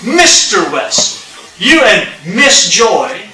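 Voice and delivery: slow, emphatic imitation of a polite child; respectful Southern kid. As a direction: address an adult like a respectful Southern kid, drawing out each name. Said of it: "how kids talk to adults in the South that are respectful".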